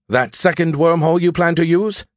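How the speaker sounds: high, teasing smart-aleck AI; cocky AI voice. A narrator's reading. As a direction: tease him like a know-it-all. Skippy's voice in story.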